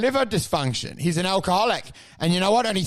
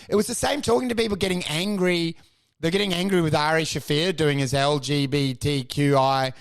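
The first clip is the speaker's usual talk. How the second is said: usual speaking voice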